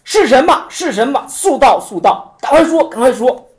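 high, loud, urgent demand; master demanding answer. Retold or imitated. Zen master pressing a monk, urgent loud demand. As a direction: press him to answer now. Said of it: "指着怀瑾说".

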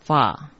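"瓦" said va, with a V; pronounced va. Shown as a demonstration. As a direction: say "瓦" as an open va. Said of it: "白字旁一个翻念成瓦".

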